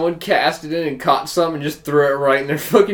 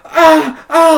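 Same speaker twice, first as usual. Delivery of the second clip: mock yell; 12 dB louder than usual. Mock scream while stabbing fish.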